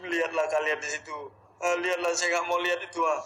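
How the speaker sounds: loud, high frightened cries of prayer; panicked loud prayer. Real, not acted. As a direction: shout the prayers in real panic. Panicked praying at apparition sighting. (berseru panik ketakutan sambil berdoa)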